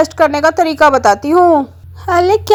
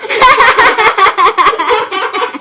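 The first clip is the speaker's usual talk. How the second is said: laugh; 4 semitones higher than usual. Acted. Mutki laughs at the stone technique.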